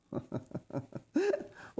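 a quick high giggle at the line; amused high laugh. Real, not acted. Reader laughing at Heidi's diplomatic answer, amused giggle. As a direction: laugh out, delighted.

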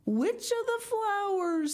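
high, slow admiring voice; delighted awe. A narrator's reading. Lucy admires Miss Pinchin's discovery, delighted awe.

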